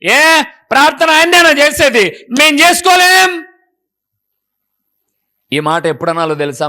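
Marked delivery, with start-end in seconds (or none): 0.0-3.5 mock-haughty scoff, higher and slower; proud scoffing voice. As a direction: play the boastful believer sneering. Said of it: "అప్పుడప్పుడు మీకు వస్తది కదా ఈ గర్వం".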